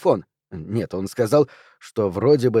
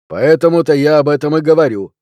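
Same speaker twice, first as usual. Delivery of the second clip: louder, insistent cop; raised policeman's voice; 3 semitones higher, 7 dB louder than usual. A narrator's reading. One policeman insisting to his partner, raised voice.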